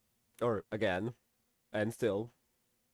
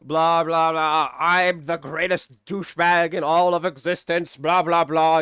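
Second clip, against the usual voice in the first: a lightly voiced, smug braggart with a bit more volume; mock him as a pompous blowhard; hammed-up imitation of a self-important jerk; mocking Sylvester; 4 dB louder, slower than usual. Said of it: "Sylvester comes in and is like".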